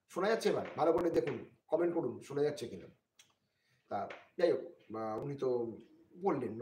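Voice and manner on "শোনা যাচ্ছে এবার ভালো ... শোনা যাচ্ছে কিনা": louder, higher call to listeners; raised voice. Real, not acted. Streamer asking viewers to comment, raised voice. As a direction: push the voice up for a sound check. Asks listeners whether audible now.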